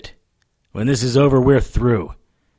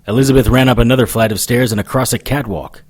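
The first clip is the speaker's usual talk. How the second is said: ordinary talk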